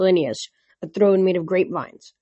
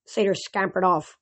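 ordinary delivery, nothing marked

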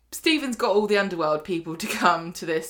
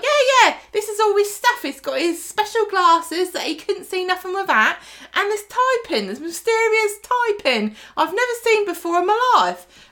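loud, high-pitched caricature of a chatty widow; hammed-up, shrieky mock widow; brash character voice; 12 semitones higher, 6 dB louder than usual. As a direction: belt it out high and cheerfully oblivious. Lou shows late husband's effects, acted.

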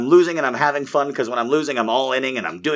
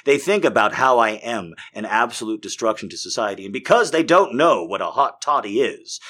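ordinary talk, nothing marked

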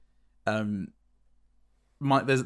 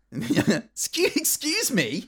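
much higher, outraged excuse me; hammed-up indignant squeak; mock offended; 12 semitones higher, much slower than usual. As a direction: play the affronted caller. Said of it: "I'd call back".

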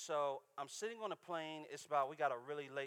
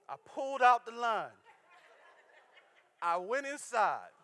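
preaching shout; 8 semitones higher, 14 dB louder than usual. Real, not acted. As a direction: belt it out to the crowd. Preacher climaxes the KFC story.

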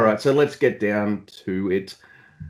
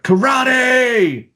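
high, loud, drawn-out battle cry; imitated yell; 5 dB louder than usual. Host mimics the wrestler yelling before a lariat, loud high yell. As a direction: yell it out like a wrestler mid-attack. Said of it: "he yells out".

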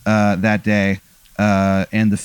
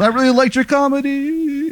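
very high, put-on calling voice; high fan wail. Swat guy praises Aaron while shooting him, high wail. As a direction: call it out way up high, stretching the end. Gunman calls out to dying comedian.

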